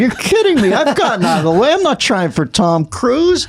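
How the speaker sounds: high, laughing mock outrage; hammed-up angry phone call, voice way up; retold outburst at Jay. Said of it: "I go".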